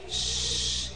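long soft "shh"; shushing. A narrator's reading. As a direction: hush for a sleeper. Hushing as Paroi sleeps.

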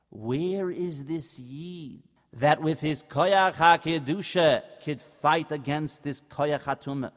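plain reading voice

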